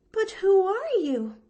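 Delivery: put-on high, eager little girl voice, a catch in the breath; a small orphan girl bravely glad; young girl Pollyanna. Orphan girl to Nancy, high and earnest.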